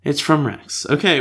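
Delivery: ordinary talk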